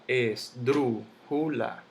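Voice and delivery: esdrújula split as es-drú-ju-la; syllable by syllable. Shown as a demonstration. Teacher sounding out the word for students, slow separate syllables. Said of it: "La misma palabra esdrújula".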